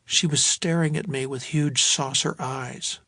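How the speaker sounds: usual speaking voice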